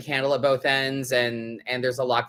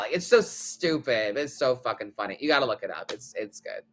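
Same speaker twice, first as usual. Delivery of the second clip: ordinary talk, nothing marked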